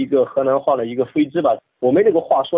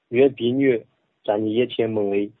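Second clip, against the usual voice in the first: Bible reading in Henan dialect; measured Henan dialect recitation; 3 semitones lower than usual. Shown as a demonstration. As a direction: read scripture in a rustic Henan accent. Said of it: "用河南话".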